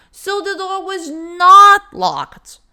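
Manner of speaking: "not" in "so the door was not locked" lifted high and loud; slow, high, heavy on "not"; stress on not. Shown as a demonstration. Teacher quoting the man's surprised emphasis, high and slow. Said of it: "Tanto que ele fala".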